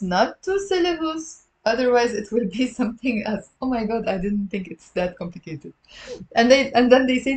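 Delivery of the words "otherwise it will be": usual speaking voice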